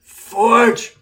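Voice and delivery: put-on slurred drunken call; drunk slurring. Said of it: "Harry says, drunkenly and slightly slurred".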